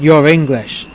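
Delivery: put-on a police officer's thick Italian accent. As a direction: say it like a Venetian cop. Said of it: "I heard a thick Italian accent".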